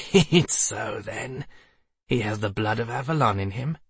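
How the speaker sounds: wheezing laugh. A narrator's reading. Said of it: "a wheezing laugh filled the chamber".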